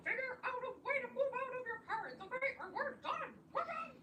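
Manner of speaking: put-on high, pushy girlfriend voice; Jenny's ultimatum. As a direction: squeak it out like a nagging girlfriend.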